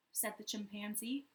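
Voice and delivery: plain reading voice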